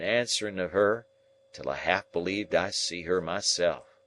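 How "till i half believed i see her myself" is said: ordinary delivery, nothing marked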